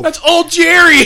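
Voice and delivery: high-pitched mock fanboy cry; excited yell. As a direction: call it out in thrilled recognition. Joins the excited cameo reaction.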